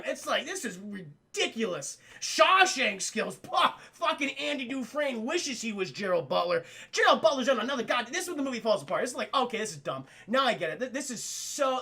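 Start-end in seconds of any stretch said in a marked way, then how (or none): none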